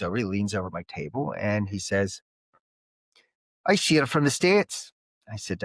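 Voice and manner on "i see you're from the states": higher-pitched, badly done Irish accent. Stranger in a Belfast pub addresses an American, bad Irish accent. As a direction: put on a clumsy Irish accent. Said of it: "it's a very bad Irish accent".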